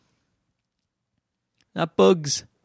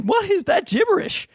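put-on pitched way up, baffled baby; high baby voice. Voicing the baby, puzzled by his dad's English. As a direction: squeak it like a puzzled infant. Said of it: "He was like".

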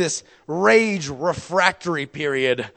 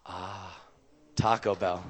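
low, soft, savoring the word; like tasting it already; savoring mmm; 7 semitones lower, 9 dB quieter, slower than usual. Retold or imitated. Savoring food choice.

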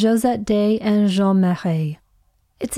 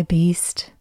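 whisper; 3 semitones lower than usual. Pretend. Playing Beauty in the scene.

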